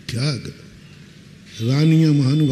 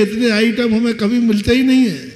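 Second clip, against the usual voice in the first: excited mock husband; husband's delight; 6 semitones higher than usual. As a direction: marvel at all the dishes. Husband delighted at many dishes.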